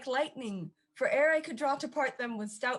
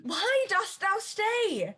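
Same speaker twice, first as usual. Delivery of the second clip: high, drawn-out urgent call; desperate, pitched-up exhortation; urgent cry; 7 semitones higher, 3 dB louder, much slower than usual. Acted. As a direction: urge a friend to flee, stretching each word. Friend urges Romeo to flee.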